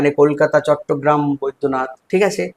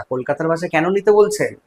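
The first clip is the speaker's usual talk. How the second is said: ordinary talk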